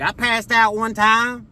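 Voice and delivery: hammed-up earnest helper; clueless lady. Lady raising her hand.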